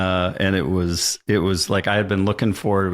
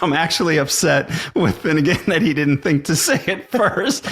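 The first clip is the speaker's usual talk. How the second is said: laughing; 8 semitones higher than usual. Real, not acted. Laughing while retelling.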